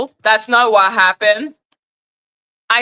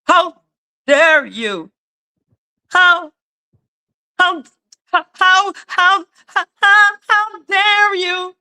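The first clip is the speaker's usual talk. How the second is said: higher, hammed-up indignant stammer; mock offended over not following Danny; mock outrage; 7 semitones higher than usual. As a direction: sputter in fake offence.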